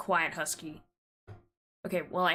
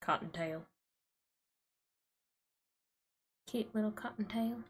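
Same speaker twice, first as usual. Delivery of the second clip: soft, thick Southern twang; Western accent; 3 semitones higher, 5 dB quieter than usual. Real, not acted. Cooing at a clipped-ear rabbit in a Western accent. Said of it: "I say it with such a thick accent".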